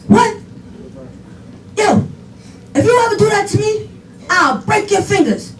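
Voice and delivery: playful retold parental threat; mock threat. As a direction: act out a dad's startled warning. Father's threat acted in story.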